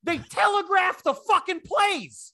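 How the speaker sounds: high, slow, incredulous rant; exasperated yelling. Acted. Fan ranting about his team's obvious plays, exasperated yelling. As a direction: wail your outrage at the team's blunder, drawn out. Acted rant at failing team.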